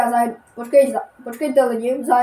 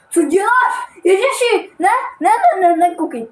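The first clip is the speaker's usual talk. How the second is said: "Co děláš?" yelled much higher and louder; a real startled shriek, stop them looking; shocked panic; 9 semitones higher, 4 dB louder than usual. Real, not acted. Panic at something on screen. (vyděšený, vysoký a hlasitý výkřik)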